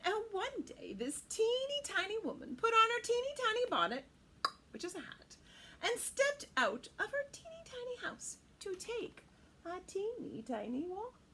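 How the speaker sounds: lilting read-aloud; storybook narration. A narrator's reading. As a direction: read it like a picture book.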